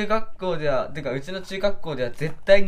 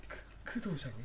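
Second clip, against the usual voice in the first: hushed whisper; 19 dB quieter, faster than usual. Pretend. Acting classmates whispering.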